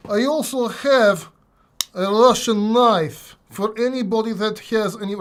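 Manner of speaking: slow, light put-on Russian accent; a hint of a Russian accent. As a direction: show off the knife in mock Russian. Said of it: "I know I'm doing a Russian accent".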